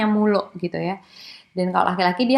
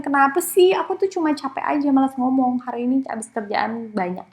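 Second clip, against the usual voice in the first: higher, mildly annoyed then fading weary reply; tired boyfriend; 5 semitones higher than usual. Retold or imitated. Lecturer imitates the boyfriend explaining he is just tired, mildly irritated. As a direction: answer like a worn-out man wanting rest. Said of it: "Padahal cowoknya bilang".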